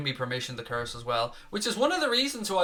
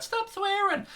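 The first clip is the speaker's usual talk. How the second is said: much higher, prissy mocking voice for a chat complainer; mock whiny chatter; slower than usual. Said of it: "before people start putting in the chat".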